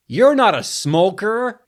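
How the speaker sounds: loud, hammed-up American accent "You're not a smoker". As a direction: belt it out in an overdone Hollywood American accent. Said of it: "I said it like".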